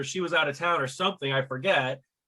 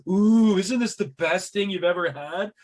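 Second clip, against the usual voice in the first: higher, louder gushing ooh; mock-thrilled fancy diners; freaking out diners; 4 semitones higher, 4 dB louder than usual. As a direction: rave over the tiny plates. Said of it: "everybody's like, freaking out".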